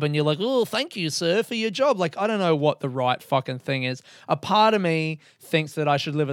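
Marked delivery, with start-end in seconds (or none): 0.4-2.0 mock servile thanks.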